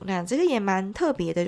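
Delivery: normal talking voice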